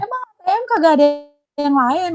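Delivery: high disbelieving question; can't believe there's no other ATM; high incredulous question to a debtor. Retold or imitated.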